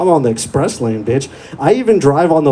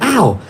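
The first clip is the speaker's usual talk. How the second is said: mock ow; 9 semitones higher than usual. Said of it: "I was like".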